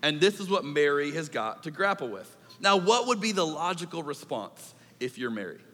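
normal talking voice

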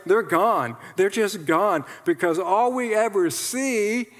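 ordinary talk, nothing marked

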